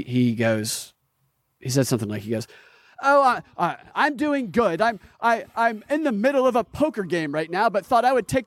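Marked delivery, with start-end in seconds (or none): 3.0-8.5 higher-pitched mock self-important guest; Andrew Tate. Guest interrupting his poker game to talk, mocking high-pitched impression. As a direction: mimic him in a raised, put-on voice. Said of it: "Andrew Tate comes on and he".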